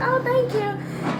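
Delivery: high, slow fake-sweet thanks; high drawn-out polite thank you; mock polite. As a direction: sweetly brush off the compliment. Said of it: "I was like".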